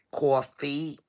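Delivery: New York accent on "Coffee". Shown as a demonstration. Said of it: "talk like a New Yorker".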